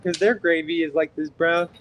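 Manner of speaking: ordinary talk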